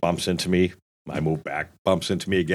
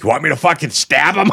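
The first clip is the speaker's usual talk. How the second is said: mock threat; 6 semitones higher, 4 dB louder than usual. Brother offering to stab.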